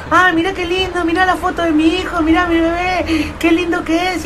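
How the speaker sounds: high-pitched cooing; gushing parent. Acted. Proud mother showing her baby's photo, high gushing. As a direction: gush in a high, sustained squeal. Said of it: "te traen un teléfono y te dicen".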